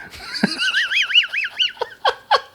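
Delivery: high, wheezy giggling fit; long squealing laugh; real laughing fit. Real, not acted. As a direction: crack up helplessly at your own story. Cracks up at own story.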